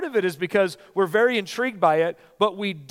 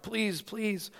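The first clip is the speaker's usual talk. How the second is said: hushed, much softer desperate plea; soft begging; 4 semitones higher, 9 dB quieter than usual. Acted. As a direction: beg quietly from the ground. Man begging on the ground.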